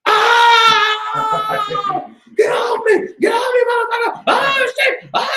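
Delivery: high, terrified shrieks torn from a nightmare; panicked screaming. Acted. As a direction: scream like something is clawing at you. Man wakes from nightmare screaming.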